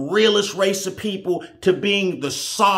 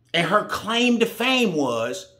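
usual speaking voice

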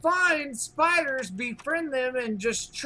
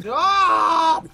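shout; 3 semitones higher, 5 dB louder than usual. Real, not acted. Pleading with friend attacking spider.

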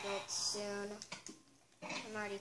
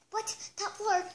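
shocked disbelief; 9 semitones higher, 5 dB louder than usual. Acted. Shocked reply.

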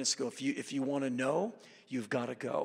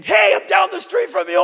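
much higher, louder excited call; 14 dB louder than usual. Pretend. Imagined Kim arriving in Hollywood, much higher and louder. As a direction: shout the good news. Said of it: "an email from Kim saying".

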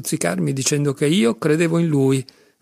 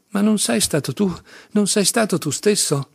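raised-pitch narrated outcry, not a shout; crying out; 6 semitones higher than usual. A narrator's reading. Alyosha can't help protesting to his brother, mild high outcry. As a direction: cry out urgently without much volume. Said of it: "non poté fare a meno di gridare".

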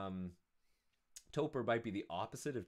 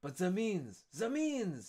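high, eager demand, quicker; Fernand voice; 9 semitones higher, 4 dB louder, slower than usual. Acted. Fernand demanding how to ruin Dantes, eager high cry. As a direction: press for the answer eagerly. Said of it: "this is Fernand speaking".

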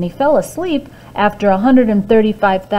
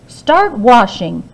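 slow, louder bossy order; giantess ordering; 3 semitones higher, 6 dB louder, slower than usual. A narrator's reading. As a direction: command him to the dishes. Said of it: "she ordered".

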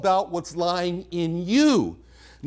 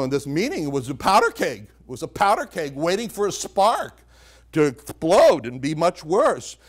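ordinary talk

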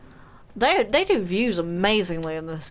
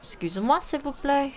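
French; 6 semitones higher than usual. Pretend. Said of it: "suddenly speaking French".

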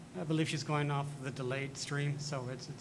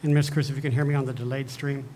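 raised voice to reach someone on a call; louder, trying to get through to her; 8 dB louder than usual. Real, not acted. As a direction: call out to her over the line. Calling to a remote presenter.

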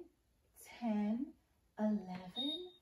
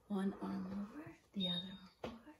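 whisper; 3 semitones lower, 9 dB quieter than usual. Acted. Hugging gesture for the kids.

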